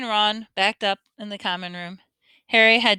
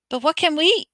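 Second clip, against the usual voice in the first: higher, breathless gasp of alarm; 7 semitones higher than usual. A narrator's reading. Hermione startled by Snape, mild gasp. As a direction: catch your breath in alarm. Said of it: "Hermione gasped".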